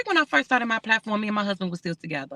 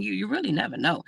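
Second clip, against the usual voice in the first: ordinary talk, nothing marked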